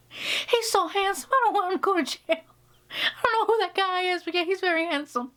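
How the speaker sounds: hammed-up mom swooning; mom gushing. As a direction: gush like a smitten mother. Mom voiced in story.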